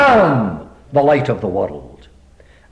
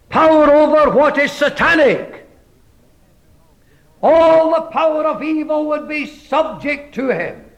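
high, rising preacher's proclamation; preaching; 10 semitones higher than usual. Acted. Preacher proclaiming God's presence to the congregation, fervent. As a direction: proclaim it to the hall with swelling fervour.

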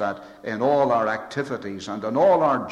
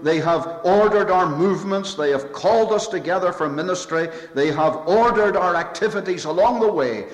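preaching build; 4 semitones higher, 3 dB louder than usual. Acted. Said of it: "rising preaching build".

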